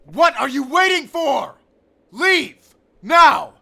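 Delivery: urgent shouted command to leave now; shouting. Acted. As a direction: yell at him to get out, fast. Urging companion to flee.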